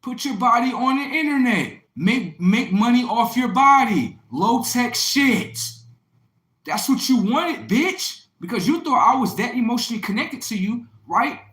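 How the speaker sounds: high, loud, slowed jeering; loud rant. Retold or imitated. Boyfriend berating girlfriend, loud and high.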